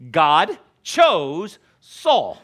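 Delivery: slow emphasis. Acted. Preacher stressing key point.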